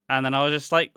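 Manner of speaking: ordinary delivery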